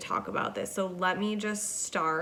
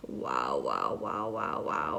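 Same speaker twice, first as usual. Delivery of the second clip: wows dropped far lower; very deep wows; 3 dB quieter, slower than usual. Real, not acted. Reeling from the joke, a string of very deep wows. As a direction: say each wow in a very low voice.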